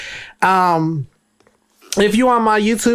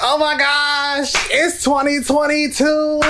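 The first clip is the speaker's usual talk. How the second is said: excited greeting; 8 semitones higher than usual. Real, not acted. High excited podcast opener.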